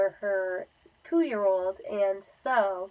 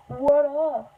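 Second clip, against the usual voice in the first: louder, higher hype greeting; playful greeting; 5 semitones higher, 6 dB louder than usual. Pretend. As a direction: call it out like a hype host. Hammed-up greeting to viewers.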